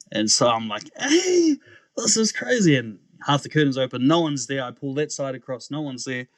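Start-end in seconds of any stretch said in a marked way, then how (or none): 1.0-2.8 high-pitched, bewildered exclamation; startled disbelief. Retold or imitated. Hearing the voice a third time, startled disbelief. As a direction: let the voice leap up in disbelief.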